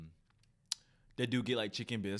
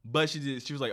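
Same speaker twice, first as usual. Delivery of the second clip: normal talking voice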